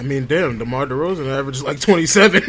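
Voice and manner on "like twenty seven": laughing. Real, not acted. Laughs at the comparison.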